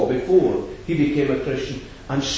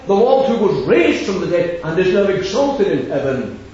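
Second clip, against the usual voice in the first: preaching at full force; 4 semitones higher, 5 dB louder than usual. Acted. Preacher to the meeting, urging them to call on the Lord, full-force proclamation. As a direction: hammer home the call to the Lord.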